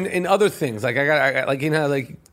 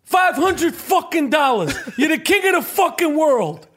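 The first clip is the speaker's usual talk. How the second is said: high, shouted tough-guy rant at Mookie; Danny Aiello quote; 8 semitones higher than usual. Retold or imitated. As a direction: hurl the money at Mookie. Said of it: "how good Danny Aiello was".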